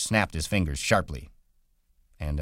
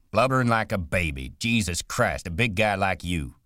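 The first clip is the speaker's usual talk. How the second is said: louder, exasperated scolding; 4 semitones higher, 4 dB louder than usual. Acted. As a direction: scold a grown man for crying. George mocks crying Lenny.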